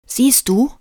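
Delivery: high, loud I-told-you-so; triumphant. A narrator's reading. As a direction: crow it in triumph. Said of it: "verkündete Lukas triumphierend".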